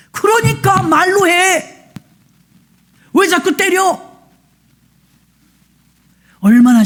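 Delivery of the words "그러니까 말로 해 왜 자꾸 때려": mocking. Mocking the wife-beater's plea.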